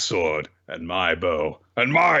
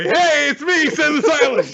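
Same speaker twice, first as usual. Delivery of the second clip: much higher, eager look-at-me voice, louder; squeaky show-off; 7 dB louder than usual. Retold or imitated. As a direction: barge in shouting your own name. Gleeful knight barging into scene.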